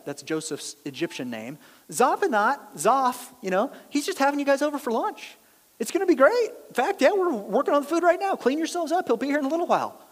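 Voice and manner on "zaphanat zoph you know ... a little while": higher, bright-eyed host reassuring nervous guests; cheerful steward. Retold or imitated. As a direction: play the chirpy steward, everything's fine. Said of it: "he tells them".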